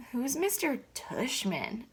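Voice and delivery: just woken up, drowsy; groggy. Acted. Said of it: "Via said groggily".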